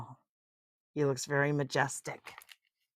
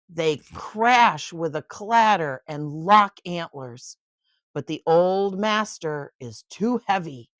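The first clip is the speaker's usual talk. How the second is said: storybook narration, lively and clear; bedtime-book reading voice; reading to a child; 5 dB louder, slower than usual. A narrator's reading. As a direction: read it aloud to a little one.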